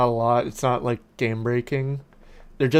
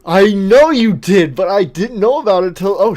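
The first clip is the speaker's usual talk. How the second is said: higher and louder, rushed; loud exasperated retort; 6 semitones higher, 10 dB louder than usual. Real, not acted. Gamer loudly argues back with friend. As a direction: push back hard and cut off.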